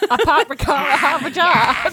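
hammed-up Boston accent, dropped r's; mock Boston vowels. Player suggesting the next guest, in a mock Boston accent. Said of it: "is the next one maybe from Boston".

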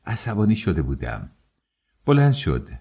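ordinary delivery

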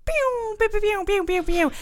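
high laser zaps; pew pew sound effect. Pretend. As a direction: fire off toy laser noises.

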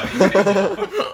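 laughing while telling; giggling through the words. Real, not acted. Laughing about the mic.

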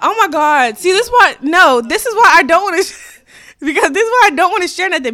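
high, loud, half-laughing outburst; loud laughing protest. Real, not acted. Protesting listener laughing at her.

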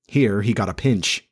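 ordinary delivery, nothing marked

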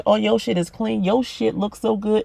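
usual speaking voice

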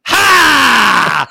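loud high "Ha!" bark; umpire's shout. Retold or imitated. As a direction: call it like a barking umpire. Umpire calls strike.

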